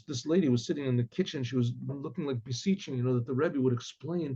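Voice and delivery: ordinary delivery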